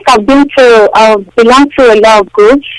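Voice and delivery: ordinary delivery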